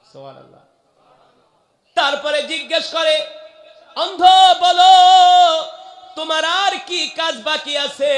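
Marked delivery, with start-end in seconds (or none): 2.0-8.1 high, loud sermon shout; full-force preaching. Acted. Preacher retelling the blind one's story, full-force shout. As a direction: ring out each phrase, high and loud.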